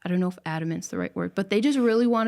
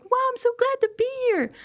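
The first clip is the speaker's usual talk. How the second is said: overjoyed exclamation; much faster than usual. Retold or imitated. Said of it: "And I'm like".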